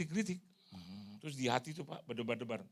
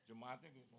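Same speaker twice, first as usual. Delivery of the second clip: usual speaking voice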